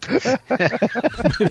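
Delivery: high bright laugh. Real, not acted. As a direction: burst into a laugh. Can't shut us up.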